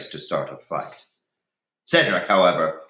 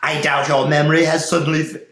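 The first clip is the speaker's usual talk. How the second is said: wrong voice; 8 semitones higher, 7 dB louder, faster than usual. Shown as a demonstration. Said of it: "wrong voice".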